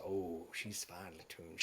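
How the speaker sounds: soft, slow, low appreciative murmur; admiring murmur. Acted. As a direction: savour the sound of a fine instrument. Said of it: "And he goes".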